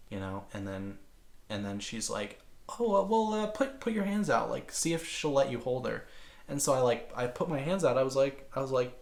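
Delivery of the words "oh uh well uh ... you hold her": higher, cheery mom voice; light imitation of the stepmom's encouragement; mock bright mom voice; chirpy stepmom. Voicing stepmom's suggestion.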